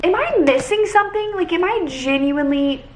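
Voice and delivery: high, disbelieving question; baffled. Real, not acted. Reader baffled by a plot twist.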